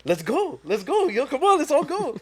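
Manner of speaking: high, loud hype-up of the gang; excited rallying. Retold or imitated. Acts out rallying the friends.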